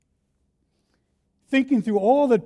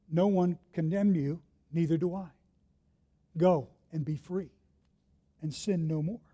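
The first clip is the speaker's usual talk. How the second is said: tender forgiveness; 3 semitones lower, 5 dB quieter than usual. Acted. Jesus speaks gently to woman.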